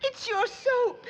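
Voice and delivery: pitched high and slow, near tears; a wailing, hurt lament; choked-up plea about the soap; tearful complaint. Acted. Drysdale distressed, on verge of tears.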